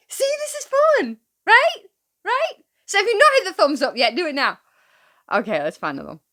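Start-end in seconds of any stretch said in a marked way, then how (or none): 0.1-2.5 high, eager host voice; bubbly, lifted pitch; excited. Real, not acted. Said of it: "excited host". 2.9-4.6 quick, bright call to action; rattled-off thumbs-up request; fast plug. Real, not acted. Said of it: "call to subscribe".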